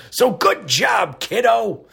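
slow and high "So good job, kiddo", a lightly voiced old-timer; folksy older praise. Host repeating the praise to a listener as an older relative, slow and high. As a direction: drawl it out like a proud grandpa. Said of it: "as us older folks say".

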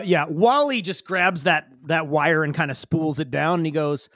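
ordinary talk, nothing marked

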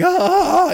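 sudden high, loud newborn shriek; baby's scream. Retold or imitated. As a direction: shriek like a baby hitting the light. Newborn baby screaming at birth.

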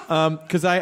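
usual speaking voice

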